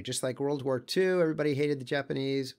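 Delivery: ordinary delivery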